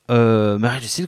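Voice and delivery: ordinary delivery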